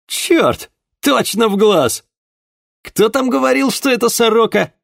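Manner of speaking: high, loud, triumphant crowing; gleeful exclaim. Acted. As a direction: crow over the lucky shot. Juan gloats over the rabbit.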